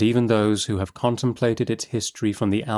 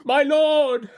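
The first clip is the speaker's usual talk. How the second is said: high desperate plea; crying out; 4 dB louder than usual. A narrator's reading. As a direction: call out to the judge in desperation. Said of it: "The man from Shropshire cries".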